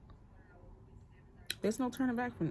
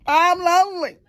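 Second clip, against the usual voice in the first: much higher and louder, a pained wail at waking up alone; exasperated loud groan; 11 semitones higher, 9 dB louder than usual. Retold or imitated. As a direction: cry it out to the ceiling, fed up. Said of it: "sometimes you're gonna wake up and be like".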